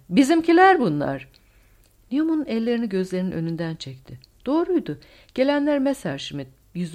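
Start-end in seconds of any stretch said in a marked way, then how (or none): 0.1-1.3 high and very loud elation; joyful shout. A narrator's reading. Co-pilot seeing their own side arrive, joyful shout. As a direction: cheer the rescuers' arrival. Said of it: "diye bağırdı yardımcı pilot sevinçle".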